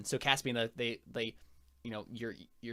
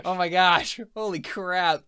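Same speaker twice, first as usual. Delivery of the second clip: excited; 6 semitones higher, 11 dB louder, slower than usual. Real, not acted. Big dice roll result.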